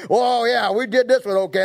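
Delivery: gloating laugh. Acted. Plotters gloat over Daniel.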